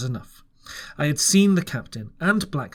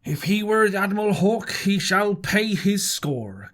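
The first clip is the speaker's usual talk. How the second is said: Silver crying out; 4 semitones higher than usual. A narrator's reading. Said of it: "cried Silver".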